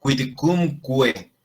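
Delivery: slow QUIDCUMQUE, each qu as kw. Shown as a demonstration. Latin teacher models a tongue twister, QUIDCUMQUE slowly with kw. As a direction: say "kwid-kum-kwe" slowly for Quidcumque. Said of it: "se lee por separado la letra Q y la U".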